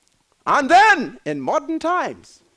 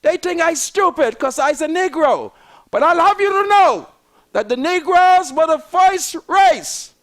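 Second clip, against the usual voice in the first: louder, higher defiant declaration; defiant raised voice; 4 semitones higher, 6 dB louder than usual. Real, not acted. A speaker defying the government, raised and defiant. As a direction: proclaim it to the crowd.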